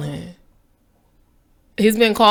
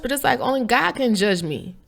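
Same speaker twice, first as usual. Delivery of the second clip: normal talking voice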